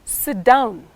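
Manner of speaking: firm falling "Sit down.". Shown as a demonstration. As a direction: let "Sit down." drop at the end. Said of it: "this is called the falling tone".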